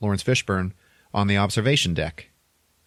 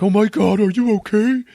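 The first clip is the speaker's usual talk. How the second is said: much higher and louder, startled concern; alarmed surprise; 14 semitones higher, 8 dB louder than usual. Retold or imitated. Jim startled to find Aurora almost drowned, high, loud alarm. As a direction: blurt it out in shock. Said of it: "And Jim is like".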